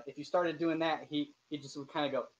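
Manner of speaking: normal talking voice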